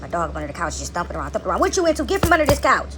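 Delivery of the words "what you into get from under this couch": scolding the dog. Real, not acted. Owner calls her thumping dog out from under the couch, raised voice.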